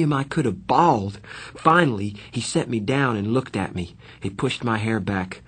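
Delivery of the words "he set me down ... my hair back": usual speaking voice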